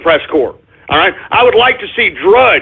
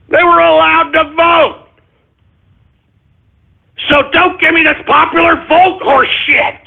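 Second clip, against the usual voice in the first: raised, heated rant at liberals; angry rant; 6 semitones higher than usual. Real, not acted. Host rails at popular vote claim, heated. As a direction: push it up, fed up and furious. Ranting at liberals, pitch rises.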